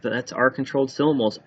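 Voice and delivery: normal talking voice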